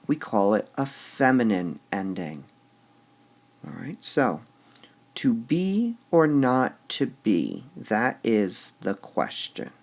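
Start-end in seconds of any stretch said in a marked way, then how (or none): 5.1-9.7 to be or not to be read slowly, beat by beat; measured meter reading. Shown as a demonstration. Teacher reads Hamlet slowly to show the stressed be. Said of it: "they really do seem to be emphasizing the be".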